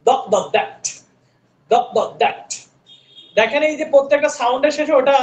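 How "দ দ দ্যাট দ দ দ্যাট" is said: দ্যাট with the tongue between the teeth; voiced th. Shown as a demonstration. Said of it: "জিহ্বার মাথাটা দুইটা দাঁতের মাঝ দিয়ে".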